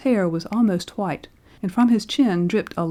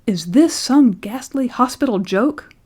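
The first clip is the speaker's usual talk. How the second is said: loud, high enraged demand; furious outburst at a bad joke; raging; 5 semitones higher, 5 dB louder than usual. A narrator's reading. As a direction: terror boiling over into rage. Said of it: "his terror resolving into rage".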